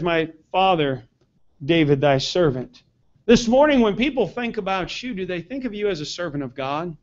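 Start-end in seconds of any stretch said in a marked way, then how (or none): 0.0-2.8 humble reverence. Retold or imitated. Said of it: "He says".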